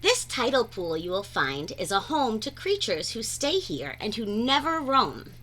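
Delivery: ordinary delivery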